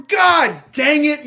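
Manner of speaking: slow, loud, high cry of frustration; frustrated outburst. Real, not acted. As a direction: drag out real anger at the pick. Reacting to bad draft pick.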